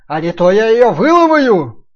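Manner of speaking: slow, very loud wolf threat; roaring wolf. Acted. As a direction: roar the threat slowly. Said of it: "прорычал волк".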